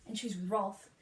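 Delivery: ordinary talk, nothing marked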